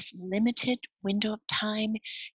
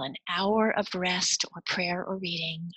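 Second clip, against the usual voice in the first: usual speaking voice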